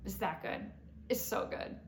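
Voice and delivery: soft, near-whispered aside; quietly confiding delight; hushed raving. Real, not acted. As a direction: drop to a hush, savouring how good it is. Hushed aside savouring the recipe.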